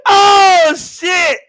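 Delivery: high, loud laughing yelp; cracking up. Real, not acted. Laughing hard at his own story, high yelp. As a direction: burst out laughing.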